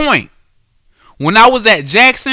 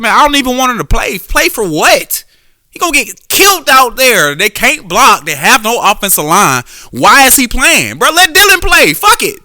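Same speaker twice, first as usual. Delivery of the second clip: higher-pitched, worked-up retelling of a protest; exasperated protest; 6 semitones higher than usual. Retold or imitated. Fan telling his homeboy to bench the quarterback, exasperated. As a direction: argue it to your buddy in mild exasperation.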